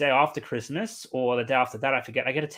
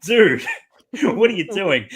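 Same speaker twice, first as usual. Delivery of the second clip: loud, higher shout of disbelief; much louder, a laugh breaking in; exasperated shout; 6 semitones higher, 8 dB louder, slower than usual. Retold or imitated. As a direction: call out a friend who messed up, laughing. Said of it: "I was like".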